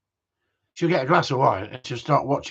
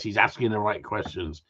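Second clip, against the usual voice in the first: normal talking voice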